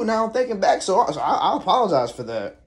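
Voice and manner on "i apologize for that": ordinary talk, nothing marked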